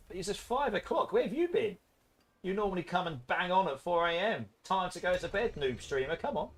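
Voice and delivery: high, soft pet-talk voice; playful cat talk. Pretend. Streamer chatting with the late-arriving cat, playful pet voice.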